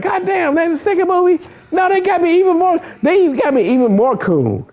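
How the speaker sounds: squeaky hammed-up complaining; high mock Boyega voice. Boyega griping about the second movie in a high mock voice. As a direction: whine it high like a fed-up actor. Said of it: "John Boyega like".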